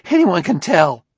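high, loud outburst; agitated retort. Acted. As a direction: fling it back, still worked up. Agitated suspect snaps back after shrieking.

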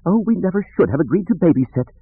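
high fussy droid wail; wailing C-3PO. A narrator's reading. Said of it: "three P O wailed".

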